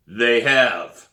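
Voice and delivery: loud, emphatic correction; stern principal. A narrator's reading. Principal to Sam about detention, loud and stern. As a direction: cut off the excuse loudly.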